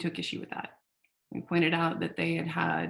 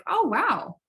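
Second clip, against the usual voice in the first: mock surprised; 2 semitones higher, 6 dB louder, much slower than usual. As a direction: play the friend faking delight. Friend reacting to offer.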